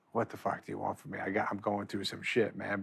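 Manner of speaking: low, quiet imitation of a friend's silent look; imagined fed-up retort. Comic voices late friend's look at him, quietly fed up. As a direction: voice his unspoken complaint, softly and low.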